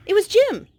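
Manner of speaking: high, loud surprise reveal; excited reveal. A narrator's reading. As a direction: announce it brightly to the child.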